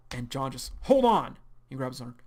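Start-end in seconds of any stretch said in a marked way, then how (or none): 0.8-1.4 "Hold on." shouted higher and louder, falling hard on "Hold"; louder demand. Acted. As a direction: insist with a loud sharp "Hold on.". John stops Arlo again, harder.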